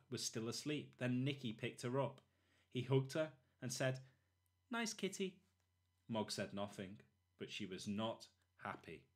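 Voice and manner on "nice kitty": put-on high cooing child voice; small boy voice. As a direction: coo it like a little boy. Nicky hugs the cat.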